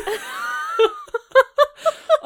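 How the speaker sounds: high shrieking laugh; screaming laugh. Real, not acted. Huge laugh shout.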